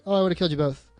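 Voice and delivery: ordinary delivery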